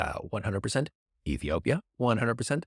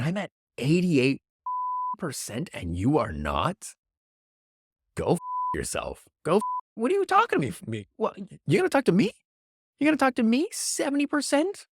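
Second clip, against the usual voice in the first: mock outrage as Brazil; 9 semitones higher than usual. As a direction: play Brazil snapping back, hammed-up.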